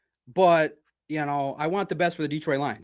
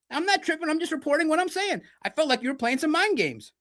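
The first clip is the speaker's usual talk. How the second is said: much higher, insistent retelling of his reply; retold heated reply; 11 semitones higher than usual. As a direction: replay the argument pitched way up. Retold argument with a player.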